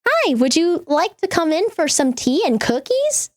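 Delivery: high, overly sweet welcoming voice; sweet hostess voice. Retold or imitated. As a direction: naive and cheerful, greeting a monster. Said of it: "She'd be like".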